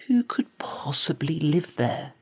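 breathy whisper to himself; whispered thought. Acted. Jack whispering his wonder at the strange castle. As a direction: wonder aloud under the breath.

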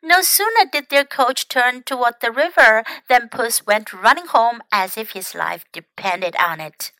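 ordinary delivery, nothing marked

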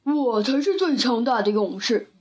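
lightly voiced boastful bear; a hint of a bear's swagger; bragging bear. A narrator's reading. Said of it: "熊说".

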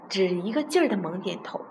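ordinary delivery

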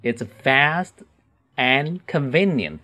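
broken into two careful chunks; slow, separated model reading. Shown as a demonstration. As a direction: pause after the first phrase, for learners. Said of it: "慢读一次".

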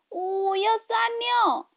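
high, trembling little voice; shivering. A narrator's reading. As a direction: shiver while you plead. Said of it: "小猫一边哆嗦".

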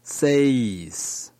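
"says" with a long ei diphthong; double vowel. Shown as a demonstration. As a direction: glide from e into i in "says". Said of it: "六是双母音".

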